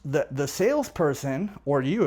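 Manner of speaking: ordinary delivery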